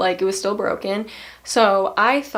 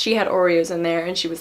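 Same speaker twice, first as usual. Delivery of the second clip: ordinary delivery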